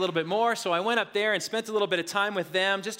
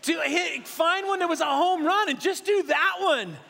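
put-on kids urging; 6 semitones higher than usual. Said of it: "they're saying".

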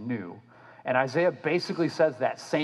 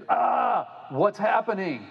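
high panicked yelp; hammed-up fright; mock panic; 7 semitones higher, slower than usual. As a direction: squeal it in fake alarm. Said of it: "like, they're".

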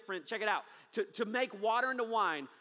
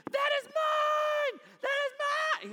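put-on high, loud, slow toddler scream, much higher; 7 dB louder, slower than usual. Preacher imitating his baby son losing his mind, screaming toddler. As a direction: shriek like a furious baby, much higher and louder. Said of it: "he is screaming".

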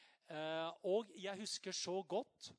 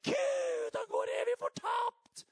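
very high, loud despairing shout; hammed-up frantic parent crying out; 12 dB louder, slower than usual. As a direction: cry out in mock despair to God. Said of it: "bare helt fortvilet".

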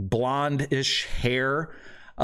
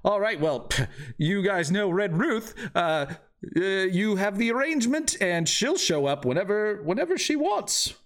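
high-pitched NPC thrilled to hear the name; excited shopkeeper voice; 8 semitones higher than usual. Acted. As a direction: gush like a delighted old friend. Same NPC continues.

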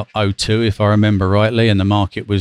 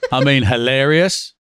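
usual speaking voice

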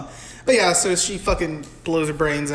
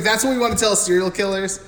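normal talking voice

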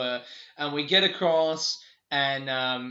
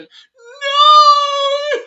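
loud, high, over-the-top "no"; cartoonish wail; 17 dB louder than usual. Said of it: "it was like a cartoonish reaction".

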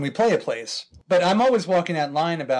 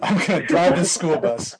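laughing; 2 semitones higher, 5 dB louder than usual. Retold or imitated. Laughing Russian drunk impression.

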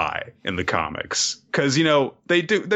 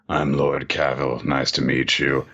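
lordly introduction; 9 semitones lower than usual. Acted. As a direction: introduce yourself with deep composure. Voicing menacing lord introducing himself.

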